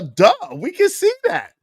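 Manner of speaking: usual speaking voice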